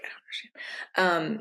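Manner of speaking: slow, breathless whisper of confusion; hushed, lost and unsure. Retold or imitated. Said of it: "even if you're like".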